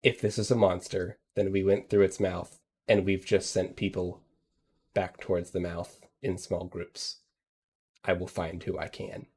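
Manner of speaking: fading frightened explanation; quiet frightened voice. Acted. Frightened NPC repeats warning.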